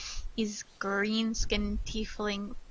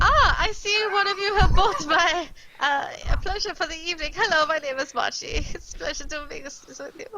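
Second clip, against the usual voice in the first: much higher, louder, camp and quick; flamboyant tiefling host; 6 semitones higher, 5 dB louder, faster than usual. Acted. Tiefling courtesan greets the party who bought his evening, camp and high. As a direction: purr it like a showy courtesan greeting clients. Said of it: "that's the wrong accent. Hang on".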